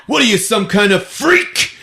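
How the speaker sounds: high-pitched mock outrage; outraged outburst. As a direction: recoil and demand it in a high voice. Bitten man pulls her off.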